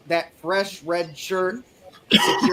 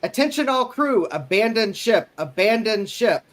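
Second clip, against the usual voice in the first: shouting abandon ship; 5 semitones higher, 4 dB louder than usual. Pretend. Mock ship alarm announcement.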